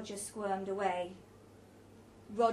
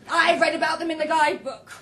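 very loud, higher voice; booming know-it-all announcement; loud opinionated declaration; 6 semitones higher, 13 dB louder than usual. A narrator's reading. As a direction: proclaim it to the whole table. Roger loudly airs his opinion.

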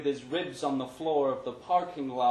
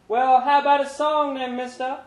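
put-on much higher, loud cheeky kid; street kid's voice; 13 semitones higher, 11 dB louder than usual. A narrator's reading. Panhandling kid calling to Larry, high and loud. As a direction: call out to the passer-by.

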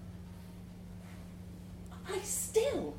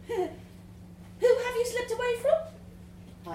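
unsure high question; 13 semitones higher, much slower than usual. Real, not acted. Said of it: "I hesitate, unsure".

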